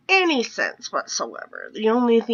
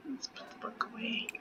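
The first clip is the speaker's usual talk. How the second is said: whisper; 18 dB quieter, faster than usual. Real, not acted. Quiet aside while playing.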